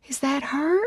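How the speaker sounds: high, thin teary squeak. Acted. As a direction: barely squeak it out, near tears. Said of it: "I barely squeak out as tears fill".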